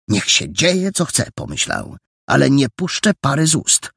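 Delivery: put-on a high boyish voice, stubborn resolve; a frightened boy swearing to himself to stay silent, high and stubborn; defiant boy. A narrator's reading. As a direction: steel yourself like a scared kid. Boy's defiant inner thought.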